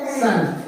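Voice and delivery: सन as a single sound, not spelled out; ending pronounced सन. Shown as a demonstration. Teacher showing both endings sound the same, सन as one syllable. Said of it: "इसका भी उच्चारण होगा".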